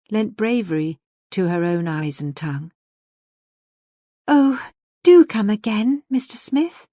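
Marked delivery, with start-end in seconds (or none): 4.3-6.9 put-on higher, drawn-out young lady's entreaty; pretty pleading. A narrator's reading. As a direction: coax a guest prettily, voice lifted high. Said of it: "she said prettily".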